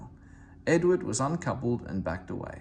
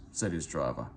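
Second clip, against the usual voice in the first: usual speaking voice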